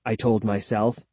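ordinary delivery

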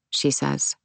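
usual speaking voice

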